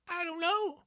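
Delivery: mocking a panicked character's cringe reply, high squeak; mock movie reply. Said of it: "Cringe-worthy dialogue".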